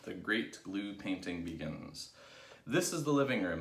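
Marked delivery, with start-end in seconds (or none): 2.6-3.6 raised, loud announcement; announcing. A narrator's reading. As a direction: announce it to the group. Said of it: "announced Mugglewump".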